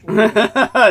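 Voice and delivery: short, loud, high laugh; amused laugh. Real, not acted. Host amused by the chewing tobacco question, laughing. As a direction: burst out laughing briefly.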